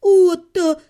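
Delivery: loud exasperated exclamation; exasperated cry. A narrator's reading. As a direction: throw up your hands saying his name. She exclaims at him.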